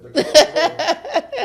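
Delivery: high, loud laughing burst. Real, not acted. Laughing recalling food stamp booklets.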